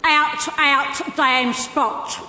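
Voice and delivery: Lady Macbeth. Pretend. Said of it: "she'd be a pretty good Lady Macbeth".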